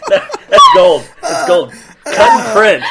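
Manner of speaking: loud, high laughter through the words; laughing. Real, not acted. Cohost laughs at the bad accent attempt, real loud laughter. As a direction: crack up while praising it.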